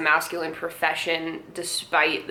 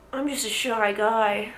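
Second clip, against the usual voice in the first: mock shy guy; 2 semitones higher, slower than usual. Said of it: "mocking a fake shy guy".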